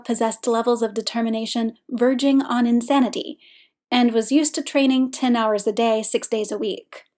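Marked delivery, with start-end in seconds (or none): none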